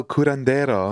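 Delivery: curandero with Spanish vowels, tapped r; Spanish pronunciation. Shown as a demonstration. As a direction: say curandero the Spanish way, koo-rahn-DEH-ro. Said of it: "How do you say the curandero?".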